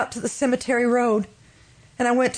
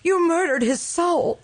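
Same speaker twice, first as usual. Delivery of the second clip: higher and slow, each word weighed; anguished accusation; 7 semitones higher than usual. Acted. Wife tells her husband he killed a soul, anguished. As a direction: lay the blame on him, slowly and painfully.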